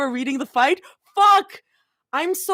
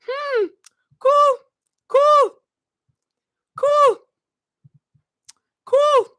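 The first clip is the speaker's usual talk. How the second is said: very high falling squeak; overwhelmed, falsetto repeats; high-pitched cool; 13 semitones higher, 4 dB louder, much slower than usual. Real, not acted. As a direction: squeak each word out separately. Overwhelmed, repeating one word.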